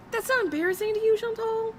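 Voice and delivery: very high, quick disbelieving question; real high-pitched incredulity; incredulous scolding. Real, not acted.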